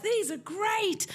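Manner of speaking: very high, drawn-out gushing; exaggerated slow squeal of approval; mimicked delight. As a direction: imitate bowled-over publishers. Publishers' delighted reaction, retold.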